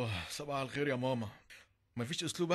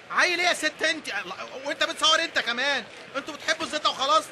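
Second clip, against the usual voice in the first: higher and louder, shooing people off; exasperated shouting; 9 semitones higher, 5 dB louder than usual. Acted. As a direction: drive the gawkers away. Son shoos the crowd. (زعيق لتفريق الناس)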